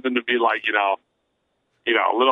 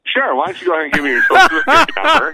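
laughing agent; 14 semitones higher, 6 dB louder than usual. Pretend. Agent asks for SSN, joking.

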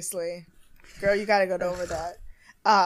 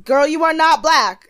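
higher, louder mock challenge; sassy callout; 7 semitones higher, 8 dB louder than usual. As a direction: snap it out like a sassy rebuke. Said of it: "Eva's like".

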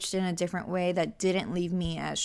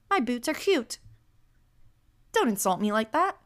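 usual speaking voice

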